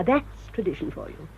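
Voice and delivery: ordinary talk, nothing marked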